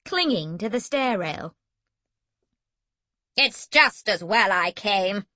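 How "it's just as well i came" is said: grim. A narrator's reading. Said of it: "Granny One said grimly".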